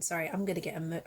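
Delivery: ordinary talk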